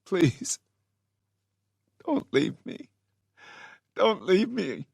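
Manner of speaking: high desperate pleading. Acted. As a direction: beg them not to go. Begging lost loved ones.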